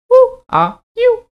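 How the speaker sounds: high hooting owl voice. Acted. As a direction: hoot it like a wise owl. Owl speaks in story.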